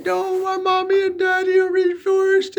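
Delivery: put-on high whiny child voice; mock child wailing about divorce; crying kid voice. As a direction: play the sobbing little kid. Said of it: "They were crying".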